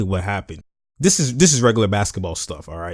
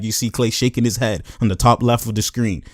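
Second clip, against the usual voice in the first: ordinary delivery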